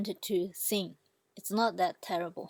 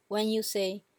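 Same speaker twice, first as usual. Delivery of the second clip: ordinary delivery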